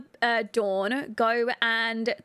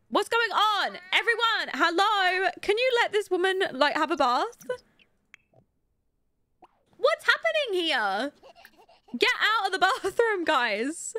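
high-pitched, indignant protest; amused exasperation, voice pitched up; exasperated scolding; 6 semitones higher than usual. Real, not acted. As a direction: shoo everyone out in disbelief. Exasperated at Sims crowding bathroom.

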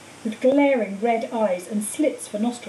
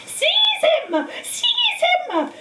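much higher, frantic shrieking; much slower than usual. A narrator's reading. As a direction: screech the order over and over. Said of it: "shrieked Voldemort again".

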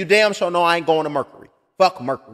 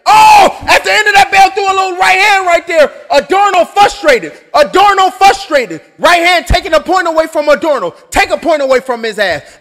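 loud, high, fired-up ringside call; excited boxing call; 6 semitones higher, 11 dB louder than usual. Acted. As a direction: shout the foul as it happens. Excited end-of-round call.

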